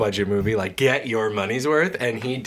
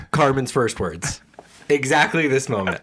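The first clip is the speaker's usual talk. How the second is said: ordinary talk, nothing marked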